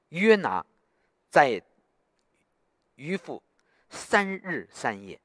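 slow, word by word, with long pauses; solemn recitation. Retold or imitated. Preacher retelling the Lord's word to the freed prisoner, slow and solemn. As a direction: draw out the scripture, letting each phrase hang.

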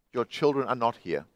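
low, soft, hollow echo voice; awful echo. A narrator's reading. The echo answers the lost man, low and soft. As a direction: answer flat and cold from the dark. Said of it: "that awful echo".

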